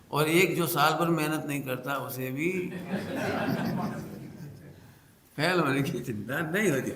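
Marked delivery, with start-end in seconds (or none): 2.6-4.5 long breathy laugh. Real, not acted. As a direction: break off mid-sentence into laughter. Laughs mid-example about lazy student. (बात के बीच लंबी हँसी)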